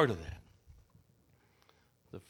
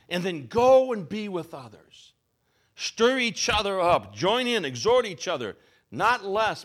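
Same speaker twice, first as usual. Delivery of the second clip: preaching; slower than usual. Acted. Preacher voicing God inviting believers to draw near, slow fervent appeal. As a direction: call the flock in like God inviting them.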